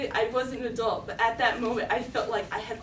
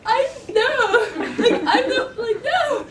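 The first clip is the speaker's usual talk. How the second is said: high, loud mock-tearful refusal; about to cry; 10 semitones higher, 6 dB louder than usual. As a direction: whine helplessly in hammed-up distress. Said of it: "And I just started crying".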